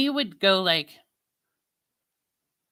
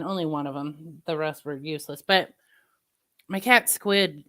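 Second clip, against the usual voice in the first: usual speaking voice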